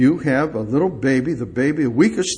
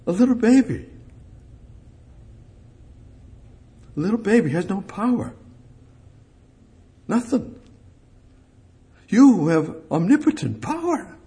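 rising preaching; 7 semitones higher than usual. Acted. Preacher contrasts baby with God.

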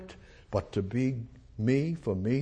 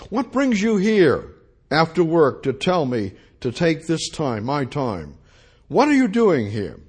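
much higher, loud, gruff demand; rough and annoyed; 4 semitones higher, 7 dB louder than usual. Acted. Company owner at his door confronting the foreman, rough and loud. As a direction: bark at the unwelcome caller. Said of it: "the owner answered it rather roughly".

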